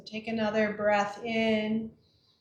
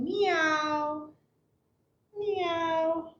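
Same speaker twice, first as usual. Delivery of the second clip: drawn-out, higher-pitched meow; cat meow; 4 semitones higher than usual. Shown as a demonstration. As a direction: meow slowly like a cat while stretching.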